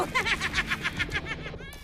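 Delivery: high, triumphant villain laugh; villain's cackle. Acted. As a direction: cackle as you fly off. Villain escapes with cat.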